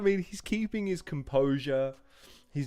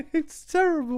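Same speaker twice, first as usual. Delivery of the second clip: high-pitched laughing groan; laughing while saying it; 12 semitones higher, 4 dB louder than usual. Real, not acted. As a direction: say it through laughter. Laughing at terrible bar.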